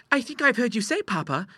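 put-on very high girlish voice; prim, high-pitched young lady; demure daughter. A narrator's reading. Clara flattering her papa about their neighbour, demurely.

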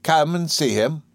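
high, slow invitation; lightly voiced nursemaid urging; coaxing nurse. A narrator's reading. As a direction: coax a reluctant child, drawn out. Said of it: "gave a gasp, as if she were swallowing".

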